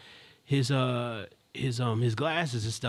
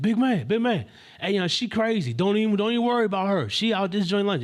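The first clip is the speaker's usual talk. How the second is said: high, raised, pushy retelling; confrontational; 7 semitones higher, 4 dB louder than usual. Retold or imitated. Man waving off bystander's worry about woman, imitated confrontational manner. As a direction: push the words out high and loud, dismissively. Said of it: "he starts saying everything confrontational".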